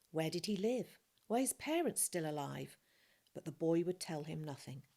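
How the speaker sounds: ordinary delivery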